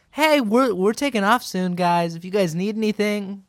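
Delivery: high, sugary friendly neighbor; mock friendly voice. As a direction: imitate an overly nice stranger. Imitating families, high friendly voice.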